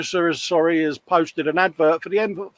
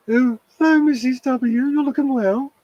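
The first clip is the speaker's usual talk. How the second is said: much higher, put-on polite phone voice for the neighbour; mock Dave going all proper on the phone; posh telephone voice; 10 semitones higher than usual. Host mocking cohost's phone voice to neighbour, higher put-on politeness. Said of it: "what Dave's telephone voice sounds like".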